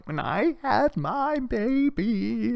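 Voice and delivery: high, soft, drawn-out mock sob; tearful high-pitched whimper, hammed up; mock crying. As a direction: imitate a woman wailing softly through her words. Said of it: "She starts crying".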